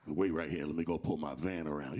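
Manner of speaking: a low-voiced guy smoothly going for his van; deep creepy dude.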